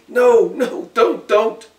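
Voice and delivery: very high loud panicked pleas; terrified screaming. Acted. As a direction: scream for your life. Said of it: "He started screaming".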